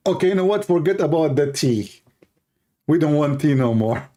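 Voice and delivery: laughing punchline. Retold or imitated. Speaker retelling his reply to the girls, laughing. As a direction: give up on tea, laughing.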